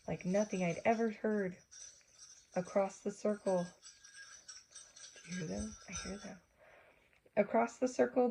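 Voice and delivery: plain reading voice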